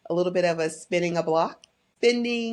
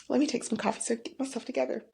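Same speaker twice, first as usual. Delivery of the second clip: collecting herself; 5 semitones higher, 6 dB quieter, much faster than usual. Real, not acted.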